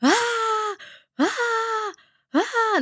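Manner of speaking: high, short imitated shrieks; mock tantrum screams; alarm-like screaming. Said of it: "gritando como una alarma".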